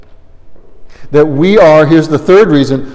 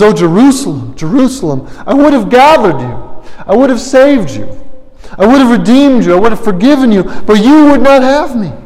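lamenting cry; 6 semitones higher than usual. Acted. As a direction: plead with the city that rejects him. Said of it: "He goes".

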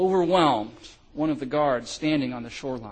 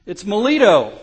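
loud startled call, higher and louder; shouted out; 4 semitones higher, 7 dB louder than usual. Acted. Guard spotting a survivor calling to comrades, loud shout. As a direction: call out to the others. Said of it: "shouted out".

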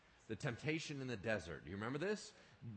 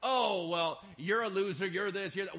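much louder, sneering insults; accusing Satan; 6 semitones higher, 9 dB louder than usual. Acted. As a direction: belittle someone as the accuser. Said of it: "Satan's already doing that".

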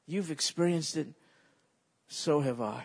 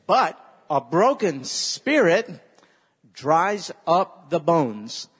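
loud and very slow, every word weighted; a slow, heavy memory line for the congregation; slow emphatic recitation; 7 dB louder, slower than usual. Shown as a demonstration. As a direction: spell it out for the church to echo. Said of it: "Say that".

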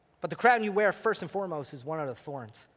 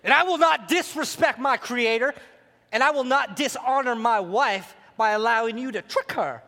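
raised, preacherly confrontation with the serpent; hammed-up defiance; 5 semitones higher, 4 dB louder than usual. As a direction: act a protective husband standing firm. Acted husband defies the serpent.

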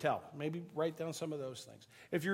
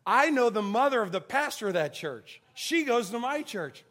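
loud, higher, emphatic bystander; proud announcement; 5 semitones higher, 9 dB louder than usual. Retold or imitated. Bagger tells pastor he knows his mother, loud and proud. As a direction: announce the connection for all to hear.

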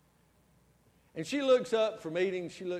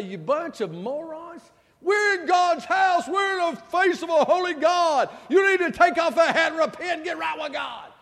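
mock angry rebuke; 12 semitones higher, 11 dB louder than usual. Pastor imagining yelling at teenage boys in church, furious. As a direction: thunder at them like a hellfire preacher. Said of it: "I didn't say".

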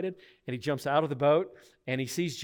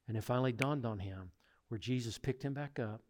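ordinary delivery

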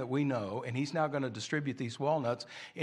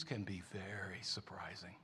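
whisper; 6 semitones lower, 13 dB quieter than usual. Acted. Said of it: "dramatic whispered line".

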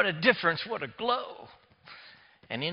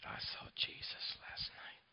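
slow faint unvoiced whisper; whisper again, slower; 14 dB quieter than usual. Dying Leon repeats his words to the preacher, a slow faint whisper. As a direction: repeat it barely audible, drawn out. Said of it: "he whispers to me".

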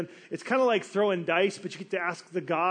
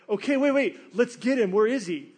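higher, louder, hurried search; frantic sailors; 4 semitones higher, 6 dB louder than usual. Retold or imitated. Sailors scrambling to find Jonah, frantic and loud. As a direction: call out like panicked crew looking for someone.

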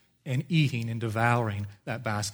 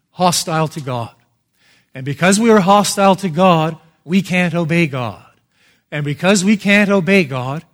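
loud, higher preaching, building then easing; forceful preaching; 4 semitones higher, 9 dB louder than usual. Acted. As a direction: hammer the point home to the congregation. Preaching the three realities.

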